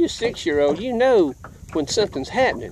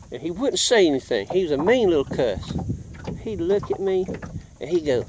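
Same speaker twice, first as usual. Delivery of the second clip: ordinary talk, nothing marked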